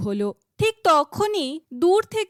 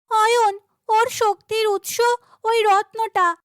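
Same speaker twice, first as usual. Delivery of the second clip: much higher, louder urgent cry; urgent call; 8 semitones higher, 3 dB louder than usual. Acted. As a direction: shout the clue mid-battle. Urgent shout in monster fight.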